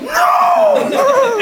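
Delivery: very high acted scream of refusal; Hulk-style freak-out. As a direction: scream like someone going berserk. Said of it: "freaks out and just goes Hulk and goes".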